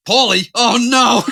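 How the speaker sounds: high, loud mock panic; hammed-up alarm, slow and loud; alarmed cry. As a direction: squeal it like a startled old man. Father-in-law alarmed at voicemail.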